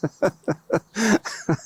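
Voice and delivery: light chuckle at himself; brief amused laugh at his own confession; sheepish laugh. Real, not acted. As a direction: laugh it off, a little embarrassed. Sheepish about unreturned book.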